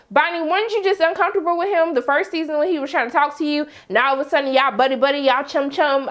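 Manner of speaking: ordinary delivery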